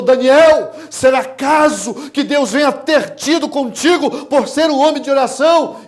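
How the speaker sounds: high, very loud cry; shouted call. Acted. King calling down to Daniel in the pit, shouting. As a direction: call out urgently at full voice. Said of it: "ele chegou e gritou".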